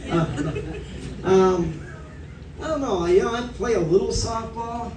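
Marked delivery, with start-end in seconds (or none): none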